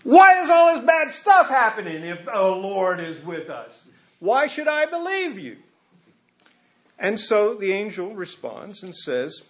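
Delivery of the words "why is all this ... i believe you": high, disbelieving challenge; incredulous Gideon. Retold or imitated. Gideon doubting the angel's promise, high and incredulous. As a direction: demand proof with a scoffing lilt. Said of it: "He says".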